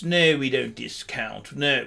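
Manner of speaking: mock-snooty, quiet and dismissive; a hint of a haughty salesman brushing off a customer; snooty shop staff. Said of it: "they have this air of snootiness".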